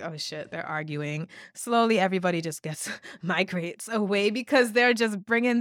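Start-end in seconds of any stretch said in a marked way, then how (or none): 0.0-1.3 hushed aside. Retold or imitated. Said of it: "they're like".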